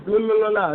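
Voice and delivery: high, loud singsong la la babble; playful high singsong baby chatter; imitated baby babble. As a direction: mimic a toddler's babbling, lightly mocking. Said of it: "they be talking like this".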